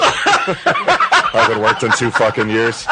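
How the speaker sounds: slow, laughing put-on confession; mock welfare guy. Faking a deadbeat's voice for his friend. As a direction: ham up a jobless drunk's boast. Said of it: "did it sound authentic".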